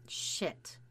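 hissed under the breath; whispered curse. A narrator's reading. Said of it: "cursed Taylor".

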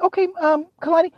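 very high, stammering questions; flustered dad. Retold or imitated. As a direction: stumble over the question. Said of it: "I'm like".

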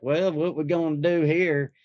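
mock a doctor with a Southern accent. Joking about a doctor nobody would want, Southern accent. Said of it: "wouldn't want your doctor to sound like".

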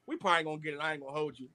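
low, soft, defeated murmur; resigned fan. Retold or imitated. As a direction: mutter it like you've given up. Imitating fan watching Herbert drive.

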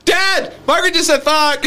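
high-pitched call to Dad; imitated child calling out on a sibling; tattling kid. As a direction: tattle like a little brother.

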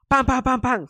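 loud, high pam pam pam; knocking imitation. Security knocking on the cabin door, loud imitation. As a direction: bang it out like fists on a door.

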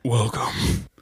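hushed creepy intro whisper. Acted. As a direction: breathe the welcome like a secret. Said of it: "Why are you whispering?".

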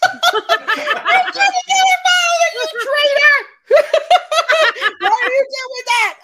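high, loud laughing yell; laughing shout. Real, not acted. Fan shouting at Paul the traitor while laughing, high and loud. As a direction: yell at him in laughing outrage.

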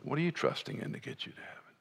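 whispered, quick, searching repeat. Retold or imitated. Preacher echoing his mentor's question in a hushed whisper. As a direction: let the question hang in a whisper.

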